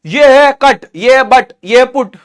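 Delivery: कट and बट with uh, पुट with oo; loud, deliberate कट, बट, पुट, vowel changing on the last; actual irregular pronunciations. Shown as a demonstration. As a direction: list कट, बट, then odd-one-out पुट. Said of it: "सब याद करना ही पड़ता है".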